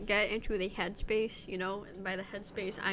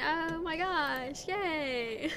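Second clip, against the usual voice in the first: put-on high, stretched Valley Girl gush; 4 semitones higher, much slower than usual. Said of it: "Okay, I mean".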